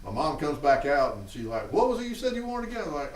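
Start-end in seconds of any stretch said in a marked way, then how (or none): 1.7-2.8 mom voice. Retold or imitated. Mom's voice in retold story.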